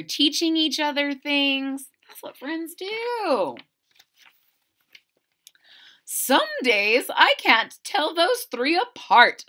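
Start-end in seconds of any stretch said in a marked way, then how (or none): 6.0-9.5 higher and louder amused voice; a mother chuckling at the kids; Lily's mother amused by the three; fond mother. Acted. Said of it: "said Lily's mother".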